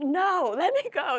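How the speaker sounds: worked-up cry to be let go; struggling free. Retold or imitated. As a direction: pull away from your cousin's grip. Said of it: "I was like".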